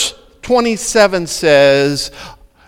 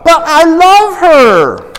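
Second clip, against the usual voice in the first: pleading objection; 8 semitones higher, 3 dB louder, slower than usual. Pretend. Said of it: "imagined objecting disciple".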